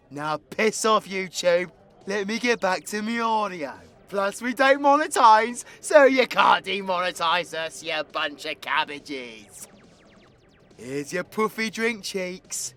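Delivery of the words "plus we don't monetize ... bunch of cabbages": loud, raised-pitch gloating retort; defiant taunt. Acted. Telling YouTube it cannot demonetize them, defiant. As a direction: throw it back loudly in their face.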